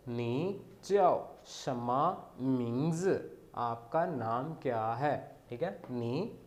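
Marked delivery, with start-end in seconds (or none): 0.1-3.2 नी च्याओ शमा मिंग्स said slowly, with च्याओ rising and carefully spaced; slow model sentence. Shown as a demonstration. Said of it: "इसका मतलब होता है name".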